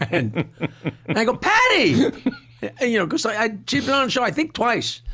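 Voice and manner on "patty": high, excited cry of her name; surprised greeting. Retold or imitated. Meeting her face to face, delighted shout of her name.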